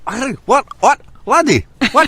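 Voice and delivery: high, squeaky playful protest; mock indignant. Real, not acted. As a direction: act offended at the teasing. Teased about his bad accent.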